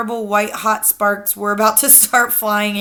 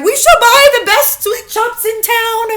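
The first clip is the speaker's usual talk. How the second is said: high, loud eager witches; high loud witch chorus; 8 dB louder than usual. A narrator's reading. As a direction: promise eagerly like fawning witches.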